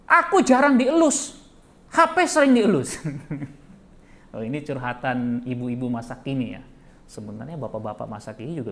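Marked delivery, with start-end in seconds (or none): 0.1-2.9 sulking wife. Retold or imitated. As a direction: whine it like an offended wife. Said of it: "dia kesel ngeliat suaminya". (istri ngambek merengek dengan suara tinggi)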